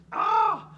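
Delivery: sudden loud high yelp of effort; strained shout mid workout; pained cry. Real, not acted. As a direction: cry out as your arms burn. Arms burning mid drill.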